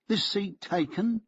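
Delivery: high, slow, polite question; Solomon's voice. A narrator's reading. Solomon asking the narrator, high and slow. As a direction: raise the voice and ask it unhurriedly.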